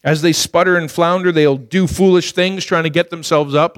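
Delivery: ordinary delivery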